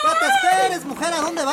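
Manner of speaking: high, loud shout; shouting after her. Acted. Suitor shouting after the runaway bride, high and loud. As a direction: yell after her as she runs. Man chasing fleeing girl.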